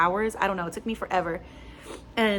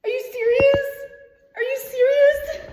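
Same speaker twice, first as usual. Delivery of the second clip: high, loud stunned squeal; real overjoyed shriek; shrieking disbelief; 7 dB louder than usual. Real, not acted. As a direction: scream it in astonishment. Learns she passed the exam.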